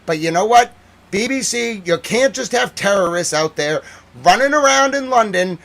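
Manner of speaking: loud, high indignant shouting; rising indignation, almost yelling; angry rant. Real, not acted. Scolding the BBC about terrorists in London, shouting.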